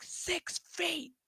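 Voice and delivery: high, slow imitated old lady; mock the old lady's warning. Mocking an old lady in a store: high and slow.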